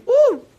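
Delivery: high, loud excited woo; high-pitched celebratory whoop. Acted. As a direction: let out a party whoop. Whoops after teasing question.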